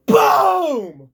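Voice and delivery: one big shouted impact noise; shouted punch sound. Retold or imitated. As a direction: yell the knockout blow. Knockout punch imitated.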